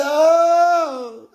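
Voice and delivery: high, loud crying Oh; mock wail. Speaker reacting to the greeting with a mock wail. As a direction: pretend to be crushed. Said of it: "I said".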